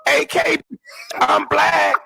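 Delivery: very high, squealed interjection; hammed-up high-pitched outburst; mock eager correction. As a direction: parody bursting in to announce it, falsetto. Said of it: "How come she ain't say".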